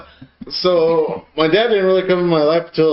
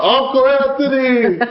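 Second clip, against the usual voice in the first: higher, drawn-out call for the uncle; mild mock yell; 7 semitones higher than usual. Retelling calling for his uncle, mild mock yell. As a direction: call through the house, half-acted. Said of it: "And I yell out".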